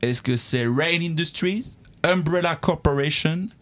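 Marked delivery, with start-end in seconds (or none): none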